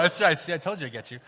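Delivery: normal talking voice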